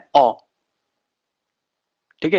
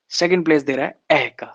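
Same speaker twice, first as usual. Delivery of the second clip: ordinary delivery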